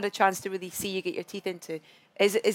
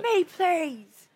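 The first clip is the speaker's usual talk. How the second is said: high squeal, please me; eager squeaky plea; 9 semitones higher than usual. Retold or imitated. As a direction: mimic a keen fan begging. Imitating eager audience member.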